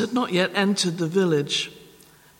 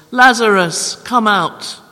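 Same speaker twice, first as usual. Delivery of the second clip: a commanding shout into the tomb; loud call; 4 semitones higher, 8 dB louder than usual. Acted. Jesus calling dead Lazarus out of the tomb, loud commanding call. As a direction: call it out loud and higher, summoning. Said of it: "Jesus called in a loud voice".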